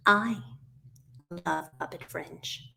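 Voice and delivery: "I" set apart, "love Puppet Fringe" slow and hushed; stress on I. Acted. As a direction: answer with a clear "I", pause, rest very soft and low. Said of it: "who loves Puppet Fringe?".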